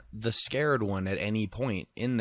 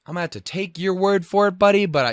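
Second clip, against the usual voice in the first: higher, louder sarcastic retort; sarcastic reply; 7 semitones higher, 7 dB louder than usual. Retold or imitated. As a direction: humor them, dripping sarcasm. Sarcastic reply to wrong directions.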